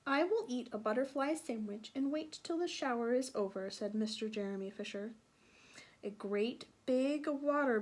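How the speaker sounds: put-on lively read-aloud voice for children; storybook narration. A narrator's reading. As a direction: tell it like a bedtime story. Continues reading the story aloud.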